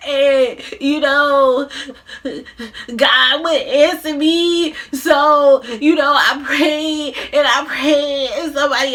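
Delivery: high, hammed-up sobbing confession; boohoo crying. Mother confessing how she got her child, hammed-up boohoo crying. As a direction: wail it through exaggerated sobs. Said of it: "she busy boohoo crying like".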